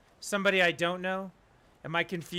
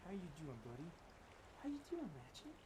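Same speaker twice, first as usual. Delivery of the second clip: doting dog talk; 22 dB quieter than usual. Real, not acted. Owner checking on his dog Ratchet, doting pet talk. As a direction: greet a beloved pet in a small, soft voice. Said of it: "I used to talk to them like that".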